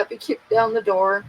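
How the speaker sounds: normal talking voice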